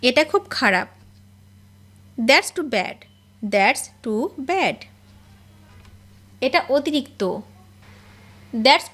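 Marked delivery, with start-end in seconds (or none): 3.5-4.8 slow and deliberate; slow repeat. Shown as a demonstration. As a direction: stretch it out word by word. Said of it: "teacher repeats it slowly".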